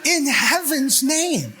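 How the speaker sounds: high, slow, laughing disbelief; as if you can't believe it; incredulous. Retold or imitated. Disciples stunned as the stranger leaves, incredulous. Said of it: "you're like".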